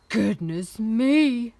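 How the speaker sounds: loud, slow exclamation; alarmed giantess cry. A narrator's reading. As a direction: cry out in booming dismay. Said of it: "cried the giantess".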